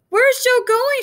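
very high, loud question; alarmed calling out. Acted. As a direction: shout the question over the storm.

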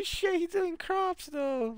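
very high, slow squeak of disbelief; amazed squeak. Real, not acted. Streamer watching enemy farm crops, very high amazed squeak. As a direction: let the voice jump way up in awe.

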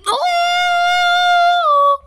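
very high startled shriek; shocked shriek. Acted. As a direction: shriek in alarm. Shocked cry.

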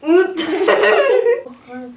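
loud giggling burst; burst of laughter. Real, not acted. After spilling water. (kahkaha patlaması)

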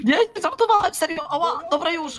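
panicked pleading. Acted. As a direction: beg in a panic.

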